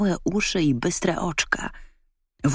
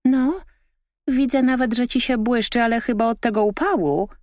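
high, loud, mocking teasing voice; spiteful raccoon; 9 semitones higher, 4 dB louder than usual. A narrator's reading. Raccoon spitefully mocks the shining dog. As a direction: needle the proud dog. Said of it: "odpowiedziało złośliwie zwierzątko".

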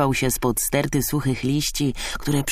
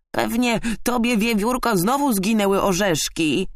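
high-pitched, mocking magpie; ironic screech; 10 semitones higher than usual. A narrator's reading. Magpie taunting squirrel about lost nuts, ironic screech. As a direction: needle her in a high ironic voice. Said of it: "zaskrzeczała ironicznie".

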